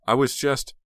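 high, loud, startled start of an excuse; flustered protest. Acted. Heather starts explaining to her uncle, flustered and high. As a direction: blurt it out, then get cut off.

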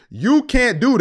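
high, loud and drawn-out refusal; stretched, high-pitched objection; emphatic rebuttal. Retold or imitated. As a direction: shut him down, slow and loud. Retold argument, rebutting the man.

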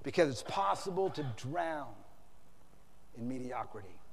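low, hushed aftermath; quiet, sober. Real, not acted. Preacher brings it home to congregation, quiet and sober. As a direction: let it drop softly after a pause.

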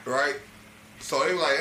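usual speaking voice

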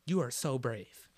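soft, slow comforting; tender praise. Retold or imitated. Older woman praising his courage, soft and slow. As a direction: say it gently, like to a grandson. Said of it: "She's like".

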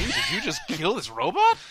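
high, loud, incredulous demand; mock outrage. Robot just got killed on screen, mock outrage. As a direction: act scandalized, pitch shooting right up.

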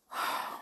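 audible sigh before a retort; exasperated sigh. A narrator's reading. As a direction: let out a fed-up breath. Tiptoe exasperated at Jolly's remark.

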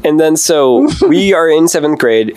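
ordinary delivery

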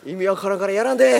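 loud, fast, barking mean-woman quote; mean old hag. Retold or imitated. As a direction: snap it like a nasty old hag. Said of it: "鬼ババアすぎるやろ".

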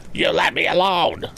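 high, raging near-scream; almost scream it. Acted. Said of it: "almost screamed Andy".

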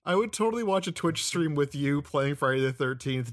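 ordinary delivery

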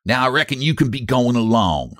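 lightly voiced backwoods robber; a hint of a rough mountain man. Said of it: "said the robber".

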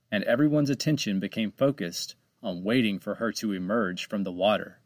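ordinary delivery

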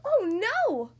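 high, loud cry of alarm; dismayed cry. Acted. Splat hearing his dad's team lost, a loud dismayed cry. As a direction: cry out in sudden worry. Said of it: "cried Splat".

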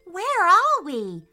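high, loud, slow alarm; panicked. A narrator's reading. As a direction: ask it in a panic. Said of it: "Archer panicked".

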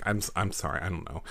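Daisy trails off into a soft, sheepish apology; half-whispered sorry, voice fading away; embarrassed murmur. Acted. Daisy apologising to the Koopa for Luigi, softly. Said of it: "And she's like".

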